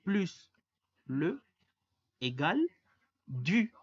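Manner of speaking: "plus", "le", "égale", "du" said word by word in French, each set apart; teacher modelling the French contraction rule; French pronunciation demo. Shown as a demonstration. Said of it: "recordemos que la unión de de".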